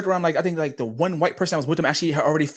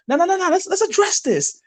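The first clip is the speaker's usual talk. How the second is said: much higher, slowing on the last line; flustered backpedal; 12 semitones higher than usual. Retold or imitated. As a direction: scramble to calm the room down. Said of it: "Be like".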